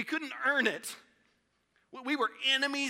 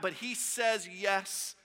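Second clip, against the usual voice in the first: ordinary talk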